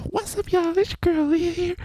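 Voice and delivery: high, far-off mock girl voice; squeaky hammed-up imitation of Leah; imitating Leah's voice. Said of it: "I couldn't figure out your pitch".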